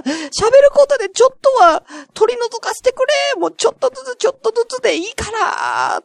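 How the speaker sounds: high, wailing entreaty; comic high-pitched begging; mock desperate plea. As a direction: beg everyone for help, stretching the endings. Begs listeners to rid evil spirits.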